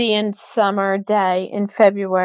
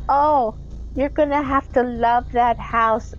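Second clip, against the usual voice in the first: put-on old woman voice; 4 semitones higher than usual.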